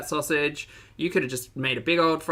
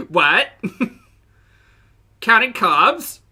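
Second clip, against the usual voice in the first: incredulous; 8 semitones higher, 9 dB louder, much slower than usual. Retold or imitated. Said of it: "It's like Ambi's mad".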